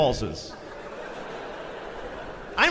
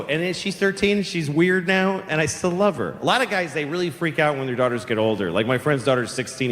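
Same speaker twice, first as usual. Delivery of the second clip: usual speaking voice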